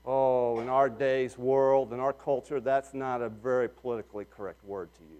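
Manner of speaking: soft, low and fast; quiet confiding aside. Real, not acted. Drops voice for aside.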